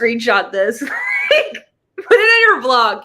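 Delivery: loud, laughing call-out; laughing shout. Real, not acted. Laughing excited outburst at friend.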